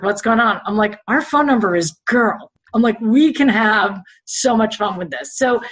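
usual speaking voice

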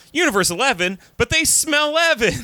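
put-on high, rising kid voice; high squeaky quote. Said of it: "He's like".

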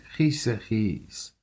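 numb, very slow; hushed horror; slow stunned whisper-like. Acted. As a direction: drag out each word in shock. Seeing the drowned child.

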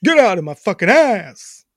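mock New York tough talk; hammed-up New Yorker; New York accent. Shown as a demonstration. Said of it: "a New York accent".

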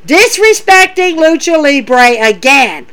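normal talking voice

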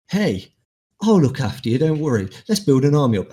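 lightly played spirit offering help; lightly voiced new being. New being enters you after defeat, lightly voiced eager offer.

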